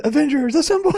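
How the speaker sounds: high heroic rallying call; rally the team, high and bold; Captain Marvel battle cry. Retold or imitated. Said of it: "in the Captain Marvel voice".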